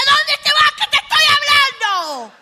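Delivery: high, loud sing-song mother's yell; mock mother shout. Mother calling her leaving daughter back, high sing-song shout. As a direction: holler it like a mom calling the kid back. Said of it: "Te va y te dice".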